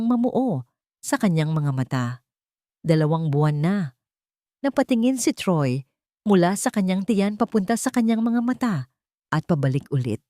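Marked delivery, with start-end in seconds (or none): none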